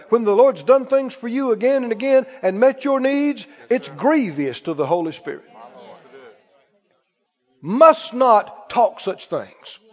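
usual speaking voice

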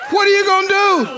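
high, loud triumphant shout; hollering taunt. Acted. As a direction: holler it as a challenge. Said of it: "I'm hollering and going".

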